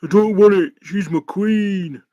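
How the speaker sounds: lightly hammed-up hero line, dragging out the second half; mock-earnest Northern hero; Jon Snow impression. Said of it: "He just went".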